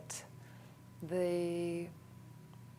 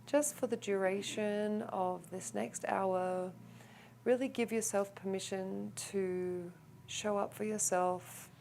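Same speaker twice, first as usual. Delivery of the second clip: calm, softer meditation voice with long pauses; guided meditation; 6 dB quieter than usual. Real, not acted. Teacher guiding the group into a clearing breath, calm meditation voice.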